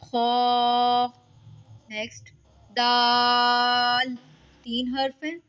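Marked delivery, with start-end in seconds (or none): 0.0-1.1 खौ said thick and loud; heavy khaa. Shown as a demonstration. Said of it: "इसको मोटा करके अदा करेंगे". 2.8-4.1 दाल held long and light; thin long daal. Shown as a demonstration. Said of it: "दाल बारीक हर्फ है".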